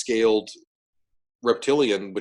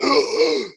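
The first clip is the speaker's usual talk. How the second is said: imitated sudden heave; retching gasp; 6 semitones higher, 4 dB louder than usual. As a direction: gasp as if about to throw up. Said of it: "I went".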